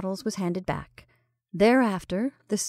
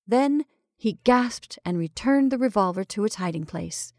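usual speaking voice

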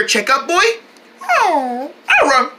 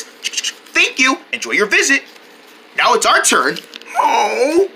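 usual speaking voice

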